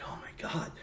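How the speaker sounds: breathless whisper; hearing the soldier's fate, stunned whisper. Retold or imitated. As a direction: hush it out in shock. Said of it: "I'm like".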